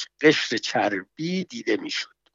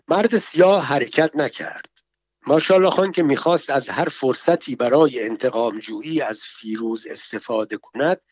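ordinary delivery

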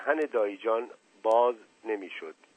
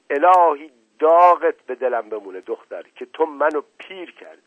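loud, raised outburst dropping at the end; yelled curse; 5 semitones higher, 10 dB louder than usual. A narrator's reading. Mother storming in at her daughter, yelling. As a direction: lash out in exasperation. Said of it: "فریاد زد".

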